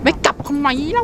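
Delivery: high-pitched exasperated complaint; exasperated protest. Real, not acted. Exasperated at teammate not returning. (โวยเพื่อนเสียงสูง)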